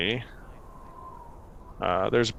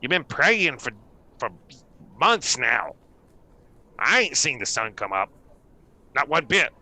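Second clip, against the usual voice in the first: a touch louder, grumbling scoff; lightly voiced gnome; 2 semitones higher, 4 dB louder than usual. Gnome grumbling to the priestess, a bit louder. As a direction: complain like a skeptical lodger. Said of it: "looks over at you and says".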